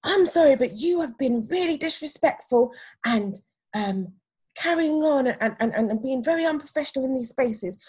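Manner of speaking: high, mounting indignant reprimand; imitated scolding. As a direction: build to a loud, affronted telling-off. Acts manager's scolding tirade.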